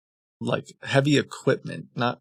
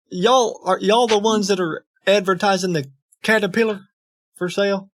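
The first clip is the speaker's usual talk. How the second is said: higher-pitched country twang; farmer twang; 6 semitones higher, 3 dB louder than usual. Acted. Farmer asks dealer about a Caterpillar, pitched-up twang. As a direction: ask it as a put-on country farmer. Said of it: "Should we be farmers?".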